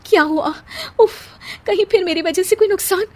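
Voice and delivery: high-pitched worried question; anxious. Acted. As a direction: fear that you caused fresh harm. Wife fears she brought bad luck.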